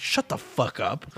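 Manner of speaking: retold retort. Retold reply to unwelcome demand.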